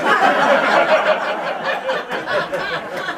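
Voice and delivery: high, unrestrained laughter at his own punchline; real delighted laugh; big real laugh. Real, not acted.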